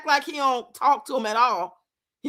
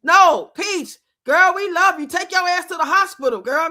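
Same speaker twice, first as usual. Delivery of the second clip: sarcastic loud cheer; 4 semitones higher, 5 dB louder than usual. Retold or imitated.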